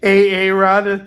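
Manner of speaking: loud, drawn-out mangled name; Key and Peele. Retold or imitated. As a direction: imitate the sketch's slow, loud roll call. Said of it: "Key and Peele".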